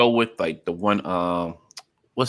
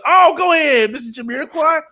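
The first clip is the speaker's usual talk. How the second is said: excited cheer; 14 semitones higher, 5 dB louder than usual. Retold or imitated. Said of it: "I was like".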